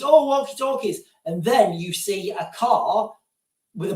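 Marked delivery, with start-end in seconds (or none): 0.0-1.0 higher, hammed-up alarm on walkie-talkies; mimicking the crowd's rising fear; mock panic. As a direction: act out a jumpy crowd, tongue in cheek. Voicing panicking public.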